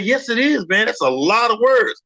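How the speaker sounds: insistent, high-pitched imitated protest; upset. Objector arguing back about how long the name is, mock upset. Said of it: "somebody was getting so upset saying".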